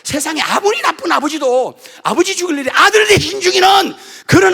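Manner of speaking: loud, high shouted challenge to God; shouting protest. Retold or imitated. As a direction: yell the protest with all your force. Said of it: "내가 막 대들었더니".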